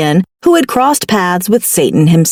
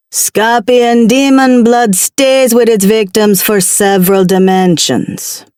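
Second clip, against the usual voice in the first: put-on Jamaican accent. Demon explains slowly.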